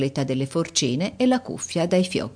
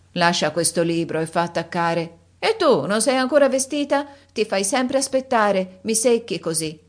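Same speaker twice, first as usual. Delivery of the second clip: put-on irritable old countess; 5 semitones higher than usual. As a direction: nag her, tired and cross. Countess scolding Lisa.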